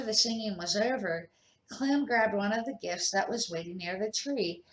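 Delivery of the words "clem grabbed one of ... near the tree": ordinary delivery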